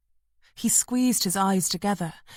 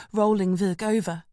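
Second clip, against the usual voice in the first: usual speaking voice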